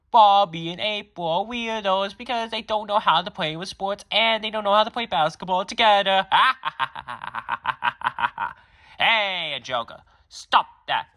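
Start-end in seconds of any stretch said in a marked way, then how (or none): 0.1-6.3 taunting bratty villain. Acted. Joker taunting the siblings. 6.3-8.5 mocking villain laugh.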